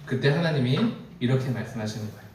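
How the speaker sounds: ordinary talk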